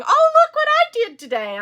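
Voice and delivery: high, loud, gushing show-off; excited friend imitation. Knitter announces her progress to the group, imitated excited squeal.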